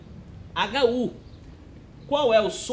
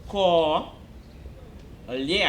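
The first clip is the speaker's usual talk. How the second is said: Co, long pause, then lhe lower; separated syllables; 3 semitones lower than usual. Shown as a demonstration. Teacher showing how the word was separated, Co and lhe each said alone. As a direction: isolate Co and lhe as single syllables. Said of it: "E a gente separou".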